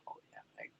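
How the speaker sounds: sarcastic aside. Retold or imitated. Students scoffing at review offer.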